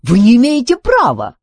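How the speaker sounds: loud, high-pitched objection; exclaiming. A narrator's reading. Duncan objects to the robots' threat, exclaiming. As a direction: protest forcefully, pitched well up. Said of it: "воскликнул Дункан".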